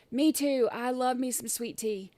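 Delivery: ordinary delivery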